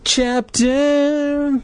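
high, squealed chapter heading; hammed-up falsetto heading; mock-dramatic announcement. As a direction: announce it in a theatrical high cry.

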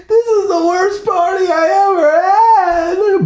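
slow, high, loud sobbing shout; Brandy crying over her ruined party. Acted. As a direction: bawl it out, crying. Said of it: "Brandy cried".

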